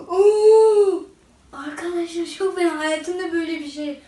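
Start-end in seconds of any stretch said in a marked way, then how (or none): 0.1-1.0 loud gasp then high cry; pained gasp. Real, not acted. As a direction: gasp from the burning heat. Burning pepper in mouth. (acıdan nefesi kesilerek bağırma)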